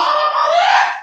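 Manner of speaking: sudden high sung-out yell. Acted. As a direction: cry out to heaven. Doctor yells mid-delivery. (صرخة عالية)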